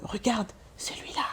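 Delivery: retold gossip whispered; whispered aside. Ivorian neighbours gossiping about a sick child, whispered. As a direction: whisper it like pointing someone out.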